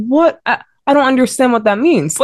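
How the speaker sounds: high, startled what, then confused; baffled. Retold or imitated. Young member answering pastors' warning, baffled and high. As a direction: sound lost and high pitched. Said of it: "I said, I said".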